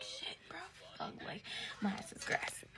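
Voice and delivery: hushed, breathy panic; scared whisper at five in the morning; panicked whisper. Retold or imitated. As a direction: whisper it so the parents won't hear. Panicked whisper as parents wake.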